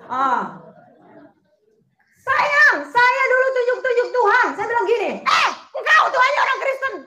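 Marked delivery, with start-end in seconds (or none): 2.3-6.9 loud, high preaching shout; impassioned shouted testimony. Acted. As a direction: cry it out at full force.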